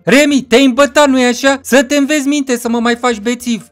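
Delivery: put-on high, loud voice scolding a drunk mate; taunting friend. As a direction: rub it in, pitched well up.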